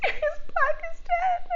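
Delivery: high, wailing sob-broken words, hammed up; mock sobbing. To her sister, retelling her own hysterical tears, a mock high sobbing wail. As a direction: imitate her teenage self sobbing the news. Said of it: "couldn't even talk through my sobs".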